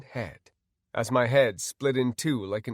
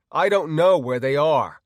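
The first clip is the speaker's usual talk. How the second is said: loud, higher insistence; raised, defiant shout; 4 semitones higher, 8 dB louder than usual. Acted. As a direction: insist forcefully to your torturer. Defiant prisoner answers his torturer.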